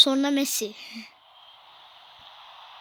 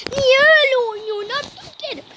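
higher and louder, scolding the player; exasperated yell; 8 semitones higher, 6 dB louder, faster than usual. Real, not acted. Boy shouting at his game player Abdülkerim, exasperated. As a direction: yell at your own footballer for playing badly.

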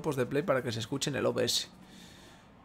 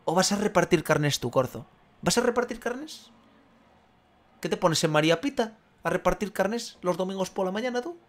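mock outraged rant; 5 semitones higher than usual. Sigue el sermón burlón, agudo.

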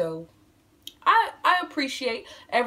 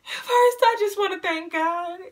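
a lightly hammed-up acceptance speech, raised higher; mock award speech; 6 semitones higher than usual. Joking to viewers about her channel, a hint of an awards speech. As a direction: play it like a winner thanking God and mama at an awards show. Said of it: "Let me stop playing".

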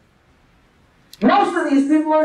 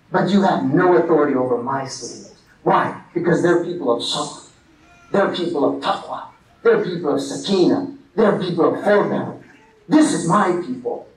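divine voice; 9 semitones lower than usual. Retold or imitated. Voicing Allah's words to Shaitan.

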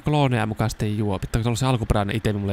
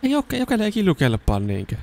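much higher, disbelieving question; mock outrage pitched up; surprised disbelief, much higher; incredulous; 10 semitones higher than usual. Real, not acted. Disbelief at game character.

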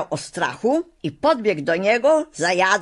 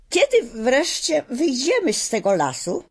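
put-on lightly raised girl's voice, eager question; a young girl asking, a touch higher; little girl asking; 8 semitones higher than usual. A narrator's reading. Dorothy asks the woodman.

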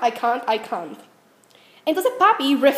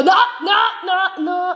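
loud, high mock yell of no; retold panicked shouting, high and loud; 5 semitones higher, 3 dB louder, slower than usual. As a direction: shout no as the water hits. Retold panic at shower water.

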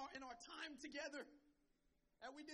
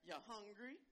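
low, understated mom voice; low-pitched question, rising at the end; mother's question; 4 semitones lower than usual. Retold or imitated. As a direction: lightly imitate mom offering food. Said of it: "she'll say".

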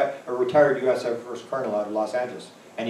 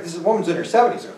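normal talking voice

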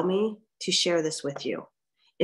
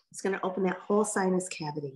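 ordinary talk, nothing marked

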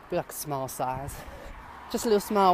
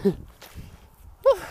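breathless laughing whew; 13 semitones higher, 4 dB louder than usual. Real, not acted.

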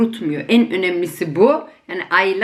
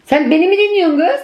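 higher, louder call across the room; louder teasing call to daughter; 8 semitones higher, 5 dB louder than usual. Real, not acted. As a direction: call over to her teasingly. Calling over to daughter.

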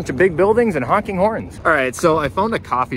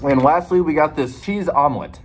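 ordinary talk

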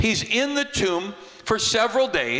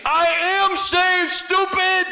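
much higher and louder, fed up; exasperated shout; 14 semitones higher, 3 dB louder, slower than usual. Acted. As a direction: yell it like an annoyed brother. Lazarus snaps at his sisters.

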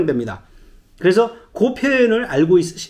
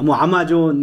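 ordinary talk, nothing marked

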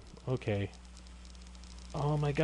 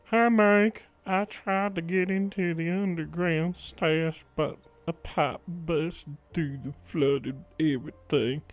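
slow, higher-pitched character read; put-on note-writer voice; 5 semitones higher, 4 dB louder, slower than usual. Player reading out a note to Mike, put-on slow voice. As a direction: drag the words out in a higher put-on voice. Reads a game note aloud in voice.

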